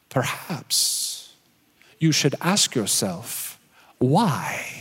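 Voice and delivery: slow, hushed, searching question; calm counselor voice. Retold or imitated. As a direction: gently ask the hard question.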